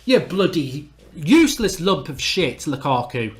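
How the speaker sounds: normal talking voice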